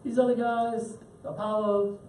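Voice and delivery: high-pitched, wheedling sales pitch for gods; cajoling Greek guys. Retold or imitated. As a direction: tease like a pushy pagan, voice way up. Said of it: "the Greek guys saying".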